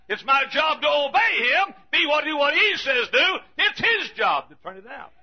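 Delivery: loud preaching building to a high shout; rousing, escalating sermon cry; full-force preaching. Real, not acted. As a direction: build to a pulpit shout, hammering each phrase.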